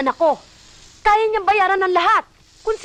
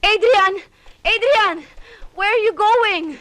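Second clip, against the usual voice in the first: put-on loud, higher calls after a child; calling out; 5 semitones higher, 3 dB louder, slower than usual. Mother calling loudly after her son Adrian, anxious. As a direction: shout after him as he walks away.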